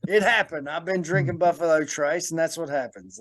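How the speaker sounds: put-on Australian accent; British accent turned Australian. Host excuses the slipping accent, still mock Australian. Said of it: "British accent turned into an Australian one".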